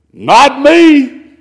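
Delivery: high, loud, quick denial; denying Peter. Retold or imitated. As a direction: push the accusation away. Peter denies knowing Jesus.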